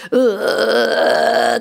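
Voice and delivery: a high, scared little moan; frightened whimper. Retold or imitated. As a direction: whimper in fear at the screen. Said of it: "もうほんと怖くて". (怖がって小さくうめく)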